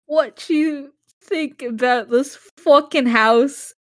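slow, drawn-out fake Australian accent; shitty Australian accent. Reading an Australian home invasion script, hammed-up Australian accent. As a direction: stretch it into a bad Australian accent. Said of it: "Australian accent".

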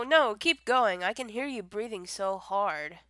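ordinary talk

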